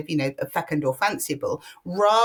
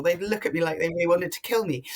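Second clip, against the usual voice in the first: ordinary delivery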